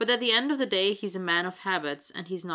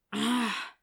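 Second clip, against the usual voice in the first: short, voiced sigh of frustration; frustrated sigh; 4 semitones higher than usual. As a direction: let out an exasperated breath. Acted wordless sigh in dialogue.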